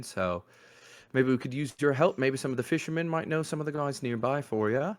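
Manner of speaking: a chatty little urchin, half-voiced; urchin boy. Game master keeps voicing the urchin, a light cheeky boy voice. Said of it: "this little boy says".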